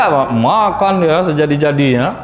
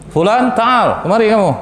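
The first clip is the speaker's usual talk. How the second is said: calling out; 9 semitones higher than usual. Acted. The Prophet calling the repentant man over, calling out. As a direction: summon him from the corner.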